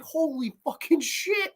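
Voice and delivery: high, slow amazed exclamation. Retold or imitated. His amazed reaction.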